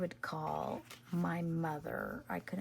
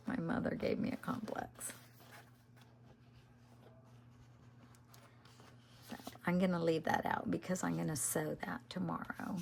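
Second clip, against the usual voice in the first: ASMR whisper. Acted. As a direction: whisper it to the viewers as you craft. Whispered crafting vlog chat.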